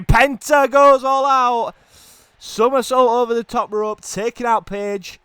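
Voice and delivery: high, loud, stretched-out move call; excited shout. Real, not acted. Commentator calling a top-rope somersault, excited shout. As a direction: roar the dive, drawing out the words. Dive over ropes, live commentary.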